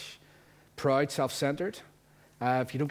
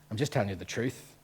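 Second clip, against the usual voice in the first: ordinary talk, nothing marked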